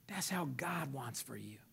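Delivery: soft whispered, slow and low; hushed whisper. Real, not acted. Preacher to listeners, slow soft whisper. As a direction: say it like a secret to them. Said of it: "hushed aside".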